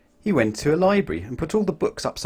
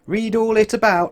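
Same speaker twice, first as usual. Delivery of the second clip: high, loud street cry; newspaper seller shout; 10 semitones higher, 5 dB louder than usual. A narrator's reading. Newspaper seller calling out, high and loud. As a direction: call it out to the street. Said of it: "shouted the newspaper sellers".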